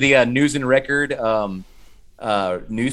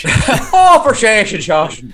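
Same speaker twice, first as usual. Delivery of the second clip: loud, high Irish put-on with laughter; mock Irish accent; 6 semitones higher, 6 dB louder, much slower than usual. Co-host joining in as the priest, hammed-up Irish accent. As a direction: ham up an Irish scolding, laughing. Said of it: "I'm taking out my Irish accent".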